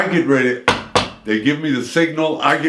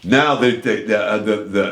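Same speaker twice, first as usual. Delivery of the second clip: usual speaking voice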